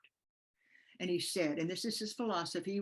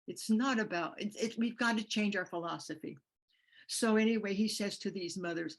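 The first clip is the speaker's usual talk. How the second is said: ordinary talk, nothing marked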